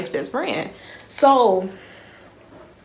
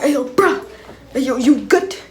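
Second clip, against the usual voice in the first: raised, louder startled check-in; mock alarm; 6 semitones higher, 5 dB louder than usual. Friends checking on gasping girl, acted alarm. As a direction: call out in acted concern.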